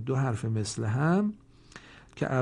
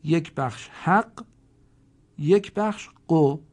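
slow, louder syllables حق then قو; 4 semitones higher, 4 dB louder, slower than usual. Shown as a demonstration. Teacher splitting the word into syllables for students, slow and loud. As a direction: spell out the two syllables slowly. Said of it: "تشکیل یافته از دو بخش".

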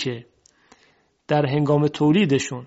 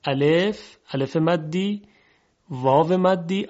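ordinary talk

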